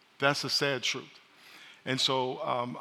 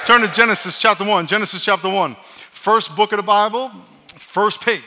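loud, higher command to open Bibles; shouted instruction; 6 semitones higher, 10 dB louder than usual. Real, not acted. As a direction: shout it so the back rows hear. Pastor shouts a Bible reading instruction.

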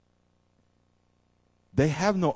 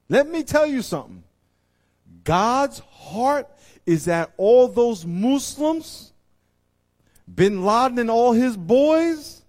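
high, loud, slow preacher's cry; fiery sermon peak; preaching at full force; 12 semitones higher, 12 dB louder, slower than usual. Real, not acted. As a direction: lift it up and call it out to the church.

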